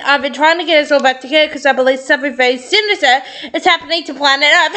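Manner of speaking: ordinary delivery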